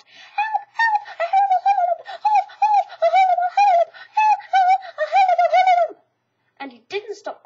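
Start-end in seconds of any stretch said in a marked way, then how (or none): 0.0-6.0 very high, loud, fast panicked shrieks; crying out in terror. A narrator's reading. Piglet scampering to Christopher Robin's house, shrieking in terror. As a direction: keep screaming while running away. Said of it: "still crying out".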